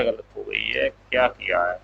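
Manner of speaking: low, subdued aside; muttering to self. Real, not acted. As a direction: say it low, half to yourself. Teacher notices own mistake, talks to self. (खुद से धीमे स्वर में बड़बड़ाते हुए)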